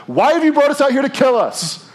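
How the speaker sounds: loud, high accusing whine; sudden shrill complaint that breaks off the song; whiny complaint. Acted. People complaining after singing.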